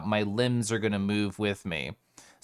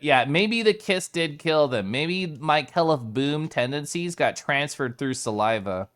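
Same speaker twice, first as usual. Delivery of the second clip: normal talking voice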